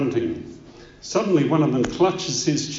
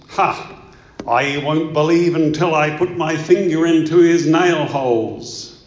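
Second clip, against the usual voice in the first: loud, scoffing skeptic, pitched up; Doubting Thomas; 4 semitones higher, 9 dB louder than usual. Acted. Skeptic refusing to believe the resurrection, loudly scoffing. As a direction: declare it in loud, defiant disbelief. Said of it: "There were skeptics like Doubting Thomas".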